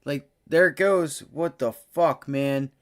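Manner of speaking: normal talking voice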